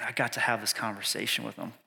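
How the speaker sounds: ordinary delivery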